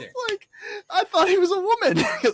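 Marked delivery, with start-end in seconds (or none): 0.9-2.0 high put-on explaining voice; mock protesting character. Lancelot explains himself to the father, high and protesting. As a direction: plead the misunderstanding in a high voice.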